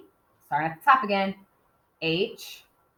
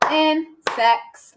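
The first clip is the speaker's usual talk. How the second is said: "Insects" broken into two higher beats; clapped syllables; 6 semitones higher, 3 dB louder than usual. Shown as a demonstration. Teacher clapping out syllables with a class, "Insects" in two beats. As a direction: split "Insects" evenly, in-sects. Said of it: "Can we clap insects?".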